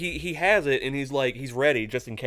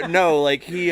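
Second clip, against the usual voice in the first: usual speaking voice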